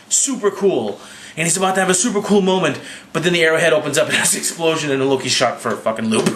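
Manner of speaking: usual speaking voice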